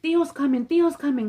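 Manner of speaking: put-on pleading kid brother yelling a warning; little brother urgently hushing a fight. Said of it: "my brother Jonathan was like".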